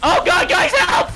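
high, loud screamed plea; panicked cry for help. Real, not acted. Player calling teammates for help, panicked shout. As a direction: scream for your team to save you.

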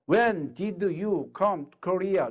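very slow English question; slow demo. Shown as a demonstration. Teacher modelling a question to an American, slow. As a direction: draw the words out for learners. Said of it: "미국 사람이 있어요".

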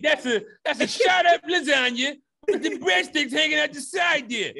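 shouted high and loud through laughter; laughing hype shout. Pretend. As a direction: yell it like a hyped-up promo, cracking up. Hyping lasagna, laughing.

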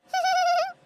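a short, high burst of giggling; happy giggle. Acted. Girl giggles over her marks, a high happy burst.

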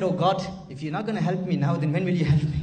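loud retold cry to a god; exasperated plea. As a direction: call out as if demanding help at last. Voices desperate plea to idol.

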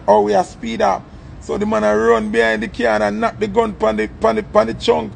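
usual speaking voice

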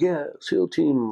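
high, loud, slow dude voice; laid-back surfer dude. Retold or imitated. SEAL checking in at the armory door, high and loud surfer-dude drawl. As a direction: play the skinny blonde SEAL all chill and casual.

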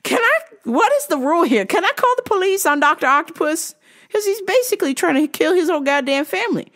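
high-pitched mock outrage; worked-up comic exasperation; exasperated rant. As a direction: plead in disbelief at the absurdity. Exasperated rant about the customer.

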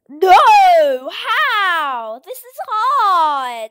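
loud high yell, stretching hard slowly; frustrated shout. Real, not acted. Gamer keeps failing, frustrated shout. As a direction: shout in exasperation at the game.

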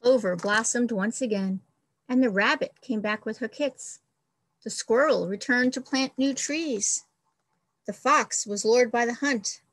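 expressive storybook read-aloud; reading to children. Acted. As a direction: read it like a picture book for the class. Teacher reads picture book aloud.

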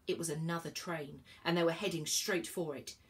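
ordinary delivery

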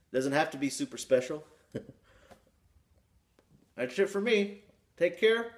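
talking around pipe stem; speaking with clenched teeth; pipe in mouth. Real, not acted. Seen 1: Bent pipe stem clenched in mouth, hand on bowl, throughout.

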